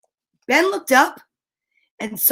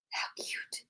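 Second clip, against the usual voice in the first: breathy aside, barely voiced; whisper; 21 dB quieter than usual. Real, not acted. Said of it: "aside to child, whispered".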